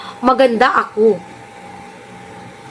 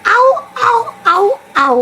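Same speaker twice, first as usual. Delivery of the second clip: high yelping dog howls, ow ow; mock husky howl; 7 semitones higher than usual. Mocking the rival's voice as a husky, howling. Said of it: "Husky voice".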